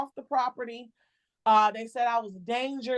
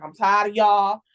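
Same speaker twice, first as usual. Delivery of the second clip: going off; 5 dB louder than usual. Retold or imitated. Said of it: "I started going off on her".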